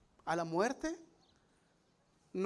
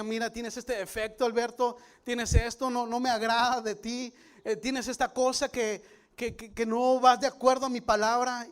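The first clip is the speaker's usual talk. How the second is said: loud, stern voice of God calling out his faults; voice of God rebuking; 3 semitones higher, 8 dB louder than usual. Retold or imitated. God scolding Alberto for his pride, loud and stern. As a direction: thunder it down as God correcting him.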